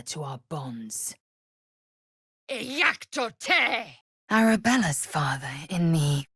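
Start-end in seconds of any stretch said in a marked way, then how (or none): 2.5-4.0 spell incantation. Acted. Casting a spell.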